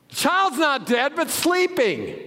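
high, loud proclamation; bold declaration. Acted. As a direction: announce it to the mourners. Jesus quoted boldly.

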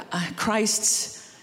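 normal talking voice

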